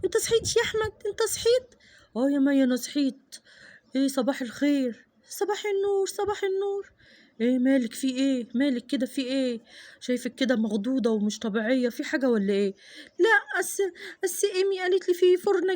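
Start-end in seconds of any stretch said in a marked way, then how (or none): 2.2-5.1 husband answering his wife; sleepy father. Acted. Father just woke. 7.4-12.7 much lower man's voice asking; husband worried about his wife; concerned father. Acted. Father notices wife upset.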